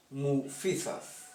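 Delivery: "Mufizaf" despacio, con acento en "fi"; pronunciación lenta. Shown as a demonstration. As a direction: marca la sílaba "fi" de "Mufizaf" al decirla lenta. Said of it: "tilde en la i". ("Mufizaf" pronunciado lento, acento en "fi")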